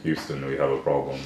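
hint of a sexy baritone for the camera. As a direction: purr the famous line into the camera, half joking. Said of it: "Say it in your sexy baritone voice".

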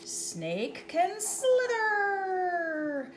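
slow, drawn-out slither; slow stretched read. Acted. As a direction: let the words slither out slowly. Reading slowly, stretching slither.

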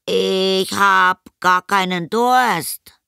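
put-on high, slow, stalling boy voice; boy making excuses. A narrator's reading. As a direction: drag out a lame excuse in a high child voice. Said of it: "angestrengt eine Ausrede überlegte". (Junge mit gedehnter Ausrede)

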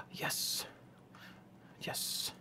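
hushed, unvoiced little triumph; whispered yes. Real, not acted. Gamer quietly celebrating a successful build, whispering. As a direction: whisper it to yourself.

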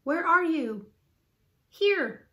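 high, carrying call and answer. A narrator's reading. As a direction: shout to someone out of sight. Calling to each other in forest.

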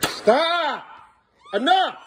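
sharp high shout to stop; shouting at his son to stop; angry yell. Real, not acted. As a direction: cut him off with a yell. Dad yells at son.

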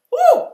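high, loud whoop of worship; ecstatic whoop. Real, not acted. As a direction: let out a joyful cry. Overcome with emotion, exclaims.

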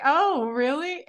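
high, drawn-out questioning reaction; naive surprise. Retold or imitated. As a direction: act mildly taken aback, rising at the end. Retold naive reaction to news.

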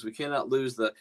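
usual speaking voice

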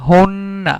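হুন্না with a long held ন; doubled consonant. Shown as a demonstration. Teacher showing the tashdid rule, হুন্না with doubled ন. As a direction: linger on the n in হুন্না, then release. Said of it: "একটা অক্ষর দুইবার উচ্চারণ করতেছি".

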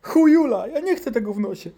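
very high wailing refusal; hammed-up loud outburst, staying high; protesting child. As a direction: refuse like a terrified kid.

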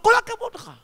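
high-pitched burst of congratulation; high, enthusiastic praise; mock praise. Mock praise called to jumper.

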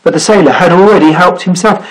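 ordinary delivery

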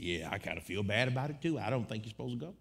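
low, hushed imitation of a hesitant cousin; subdued, low-pitched retold doubt; reluctant cousin. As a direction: drop down soft and apologetic. Cousin voices doubts, low and soft.

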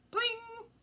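high, loud string sound; ping it up high like a plucked string; plucked string singing out high; high pling. Acted. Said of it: "went the string in a high voice".

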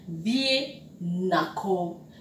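spelled out slowly, "Vie na ko" with each syllable drawn out; slow, careful teacher model of "Vie na ko". Shown as a demonstration. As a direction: say it slowly for learners to copy. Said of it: "teacher models the phrase".

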